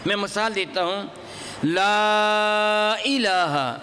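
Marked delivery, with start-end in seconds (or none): 1.6-3.8 لا drawn out very long and slow; long madd. Shown as a demonstration. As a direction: stretch the لا of لا الہ far beyond normal. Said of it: "میں نے اس کو تھوڑا سا زیادہ لمبا کر دیا ہے". (لا کو خوب لمبا کھینچ کر)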